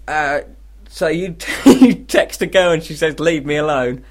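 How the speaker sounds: normal talking voice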